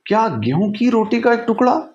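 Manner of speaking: high-pitched, rising disbelief; incredulous question. A narrator's reading. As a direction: echo it back, climbing to the last word. Girl questions oven's demand in disbelief. (हैरानी भरा ऊँचा सवाल)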